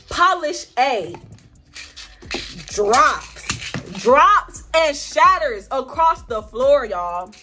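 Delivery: drawn-out words with dramatic pauses; dramatic slow-motion telling. Real, not acted. Telling viewers the polish shattered, slow and dramatic. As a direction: stretch each word out for suspense.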